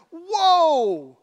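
long, loud drawn-out whoa; exaggerated astonishment; astonished. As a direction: stretch it out, high and loud, in mock shock. Reacting to the escalating curse.

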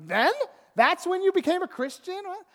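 high-pitched astonished question; mock-amazed, voice leaping up; incredulous. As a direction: react in sheer disbelief. Astonished reply to retold news.

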